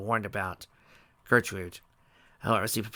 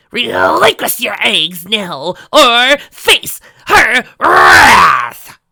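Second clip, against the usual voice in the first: very loud, high, drawn-out stage threat; theatrical scream; 13 semitones higher, 16 dB louder, much slower than usual. A narrator's reading. Trixie hamming a threat at the chicken, screaming. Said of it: "screamed in a theatrical manner".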